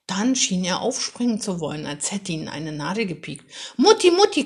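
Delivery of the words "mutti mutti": high and loud excited crow; a child suddenly crowing to his mother. Acted. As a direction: crow for mum, much higher and louder. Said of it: "krähte er laut".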